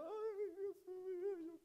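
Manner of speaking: preacher mocking a member who complains in tears; mock self-pitying complainer. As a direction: ham up a dramatic martyr moaning about his trials. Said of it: "en vez de estarte quejando".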